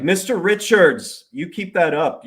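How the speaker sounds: mock judge reading him the riot act; stern judge. Judge threatening the attorney with contempt, a stern rebuke. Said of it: "It's like".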